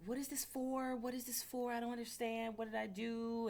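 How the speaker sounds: high, pleading "what did I do"; panicked nurse. Retold or imitated. Nurse confronted by Target staff, high and pleading. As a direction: play the confused nurse denying it. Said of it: "She was like".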